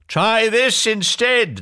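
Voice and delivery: high, drawn-out yell; slow, high-pitched princely yell; yelled retort. A narrator's reading. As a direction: yell back slowly and fiercely. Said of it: "the prince yelled back".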